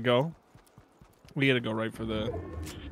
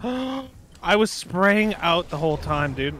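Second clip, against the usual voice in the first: drawn-out, high exasperated wail; 5 semitones higher, 4 dB louder, much slower than usual. Real, not acted. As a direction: stretch it out in disbelief. Realizes mistake, exasperated.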